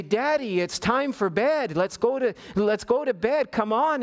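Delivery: high, slow gentle coaxing; coaxing grandma voice. Retold or imitated. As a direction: coax him off to bed. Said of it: "and I say".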